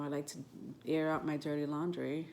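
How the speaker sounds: normal talking voice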